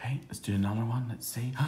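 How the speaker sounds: quick secret whisper; playful classroom whisper. Pretend. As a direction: hush it like sharing a secret.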